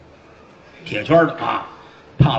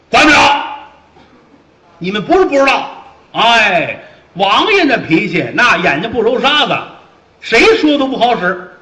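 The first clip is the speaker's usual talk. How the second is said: high, loud curt refusal; blunt refusal; 7 semitones higher, 4 dB louder than usual. Acted. Fat officer refusing to help the prisoner, loud and high. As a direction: shut them down hard.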